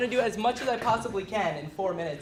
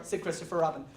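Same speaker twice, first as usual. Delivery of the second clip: ordinary delivery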